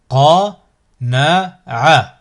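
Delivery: slow, each syllable drawn out separately; split into syllables. Shown as a demonstration. Teacher models a word for learners to repeat, split into syllables. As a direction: cut the word up, pausing between syllables. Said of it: "décomposer le mot".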